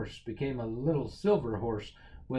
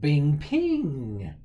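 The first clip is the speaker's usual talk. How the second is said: louder ringing imitated shot; gunshot sound; 6 dB louder than usual. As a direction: ring out the magic shot. Magic pistol fires.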